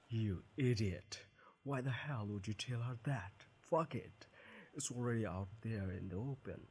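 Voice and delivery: loud, angry rant at himself; angry self-scolding. Acted. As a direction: yell at yourself for blurting it out. Scolding himself in his head.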